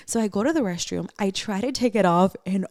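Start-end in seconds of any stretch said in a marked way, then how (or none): none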